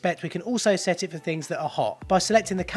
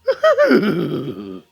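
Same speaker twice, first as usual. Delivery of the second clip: cartoon dog chuckle; 2 semitones higher, 6 dB louder than usual.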